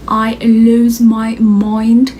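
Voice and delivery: stretched-out I lose my mind, slow; drawn out slowly. Shown as a demonstration. To her viewers, recalling the shock, slowed and drawn out. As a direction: let the words drag as if dazed.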